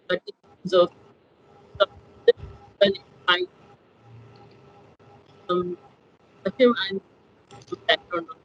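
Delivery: audio cutting in and out; breaking up over a bad mic; corrupted glitchy audio. Real, not acted. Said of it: "your audio is cutting out".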